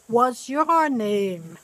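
slow, deliberate "What's your name?"; each word laid out slowly; slow model question. Shown as a demonstration. Teacher modelling the question slowly for the students. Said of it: "model question".

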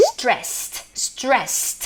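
"stressed" with a crisp final t, not ed; ending as t. Shown as a demonstration. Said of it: "เติม ed ด้วย แต่ออกเสียงเป็น t".

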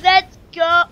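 loud, high victory cheer; excited cheer. Real, not acted. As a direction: yell it out in triumph. Celebrating a big kill count.

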